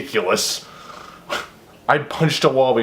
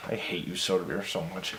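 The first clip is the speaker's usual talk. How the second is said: muttered mock grudge; 7 semitones lower, 14 dB quieter than usual. Real, not acted.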